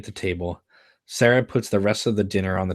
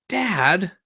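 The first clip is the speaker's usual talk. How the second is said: high, drawn-out embarrassed groan at dad; a lightly voiced teen cringing at her father; 10 semitones higher than usual. Daughter cringes at her father's giant act, embarrassed groan.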